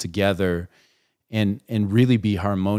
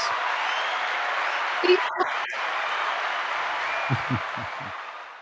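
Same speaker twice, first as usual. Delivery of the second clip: airy laugh; 5 semitones higher, 3 dB quieter than usual. Real, not acted. Host laughs after shout-out.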